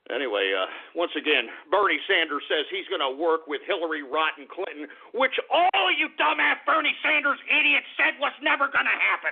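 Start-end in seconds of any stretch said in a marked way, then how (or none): 5.1-9.3 high, heated jeer; angry taunt. Real, not acted. Host taunting supporters who doubted him, angry jeer. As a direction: taunt them with raised, indignant voice.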